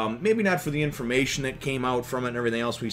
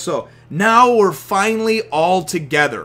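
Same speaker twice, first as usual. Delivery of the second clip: ordinary talk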